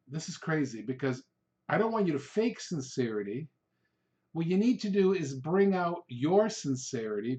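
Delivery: ordinary delivery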